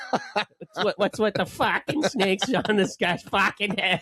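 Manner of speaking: mock the coach like an angry Boston fan; mock Boston rant. Imitating Uncle Fern.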